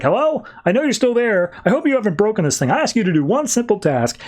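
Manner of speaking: high, fast mock scolding; scolding Lex. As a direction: nag her like a boss, hammed-up. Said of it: "he's like".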